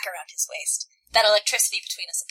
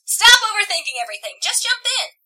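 loud, high-pitched urging; high, exclaimed character line; 12 semitones higher, 7 dB louder than usual. Acted. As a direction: call it out high and insistent. Acted dialogue from the book.

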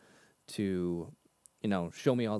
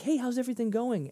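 bright pitched-up hello, imitating a churchgoer; high chirpy greeting; 12 semitones higher than usual. Churchgoer greeting him at church, high and chirpy. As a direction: pop the greeting up high and friendly.

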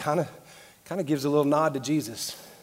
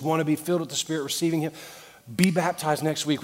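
ordinary talk, nothing marked

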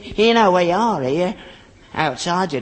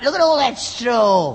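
higher, louder indignant cry; exasperated outburst; 7 semitones higher, 5 dB louder than usual. Acted. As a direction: point at the mess, higher and louder. Father inspects the damaged chaise.

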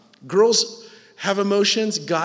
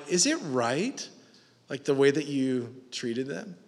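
gentle question; 5 semitones lower, 9 dB quieter than usual. Acted. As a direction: ease into it like a calm parent. Parent gently questioning children.

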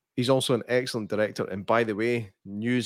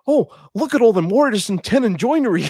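awestruck nerd; 12 semitones higher, 8 dB louder than usual. Retold or imitated. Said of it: "he's like".

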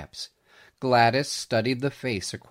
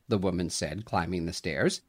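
ordinary delivery, nothing marked

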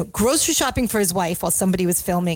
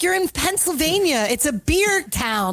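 mock scold; 7 semitones higher than usual. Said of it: "He's like".

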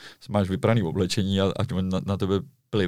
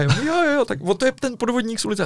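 mock tourists; 14 semitones higher than usual. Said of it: "retold quote, tourists agreeing".

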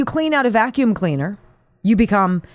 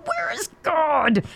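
mock bellow; 6 semitones higher, 4 dB quieter, slower than usual. A narrator's reading.

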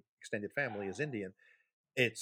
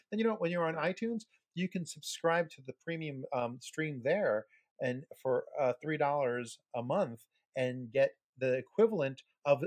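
higher-pitched, upbeat Patreon pitch; mock ad read; 4 semitones higher than usual. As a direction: read it like a cheesy ad. Continues the sponsor-style plug.

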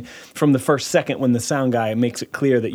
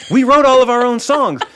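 higher and louder mock insistence; hammed-up defiant retort; insistent; 10 semitones higher, 6 dB louder than usual. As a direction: push back hard. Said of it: "And I said".

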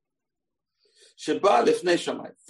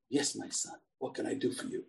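soft, lower, patient reply; calm answer; 5 semitones lower, 10 dB quieter than usual. Acted. Rabbi to the man disturbing his shower, calm and soft. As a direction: answer the pest gently and quietly, unruffled. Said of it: "gives a very calm answer".

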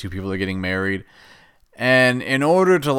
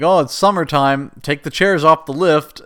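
louder, sarcastic staff voice; mocking quote; 5 semitones higher, 6 dB louder than usual. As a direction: say it like a silly official. Mock resort staff quote.

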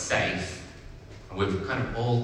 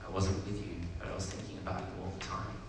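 soft, heartfelt storybook confession; from the heart; 8 dB quieter than usual. A narrator's reading. Big rabbit opens up to little rabbit, from the heart. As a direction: share it quietly and sincerely. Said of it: "shared from the heart".

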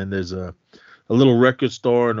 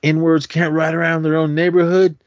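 higher and louder mock outrage; 6 semitones higher, 4 dB louder than usual. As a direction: sneer it like a young hoodlum. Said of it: "he's like".

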